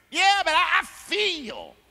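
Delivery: high, whiny I just feel; mock a fool who trusts feelings; high mocking whine. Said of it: "You a fool".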